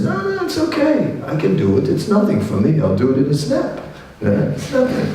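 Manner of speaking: high, breezy boasting; Hanuman shrugging it off. Acted. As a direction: toss it off as easy, quick. Hanuman brushing off Sita's objection.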